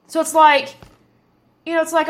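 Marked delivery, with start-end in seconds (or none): none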